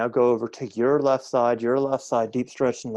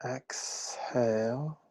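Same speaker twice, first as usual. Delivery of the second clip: very slow, softly stretched exhale cue; drawn-out cue; 5 dB quieter, much slower than usual. Shown as a demonstration. Teacher guiding a class through breath work, slow and drawn out. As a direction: stretch the word out softly, pacing a long breath.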